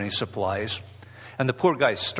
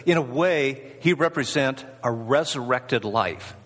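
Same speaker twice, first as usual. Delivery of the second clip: ordinary talk, nothing marked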